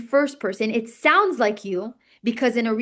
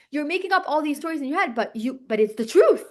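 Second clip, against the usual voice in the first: usual speaking voice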